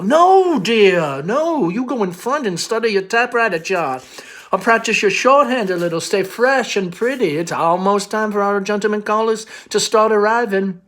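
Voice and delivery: put-on doting mother shooing her girl off, high and sing-song; chirpy insistent mother. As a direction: brightly overrule her daughter. Amanda cheerfully insists.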